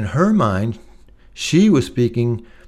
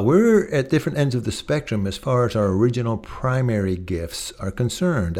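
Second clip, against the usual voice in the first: ordinary talk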